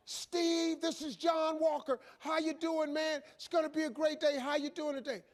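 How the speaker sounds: high-pitched, chirpy morning pep call; bursting with morning cheer; relentlessly upbeat caller. Acted. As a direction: imitate an overly cheerful wake-up caller. Same upbeat caller, repeated word-for-word.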